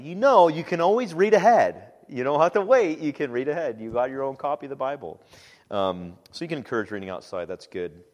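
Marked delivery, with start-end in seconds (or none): 0.0-5.2 encouraging call. Retold or imitated. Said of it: "I say".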